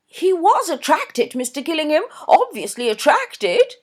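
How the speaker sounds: high, loud gushing society mother; gushing Mrs Norbury. A narrator's reading. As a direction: voice her high and effusive, a fussy mother. Narrator voices Mrs Norbury.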